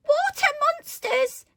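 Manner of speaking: very high, loud, slow panic cry; terrified storybook scream. A narrator's reading. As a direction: shriek it in drawn-out alarm. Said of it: "and one cried".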